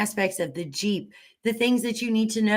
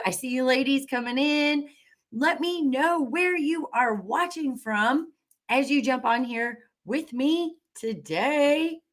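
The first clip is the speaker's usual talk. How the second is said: normal talking voice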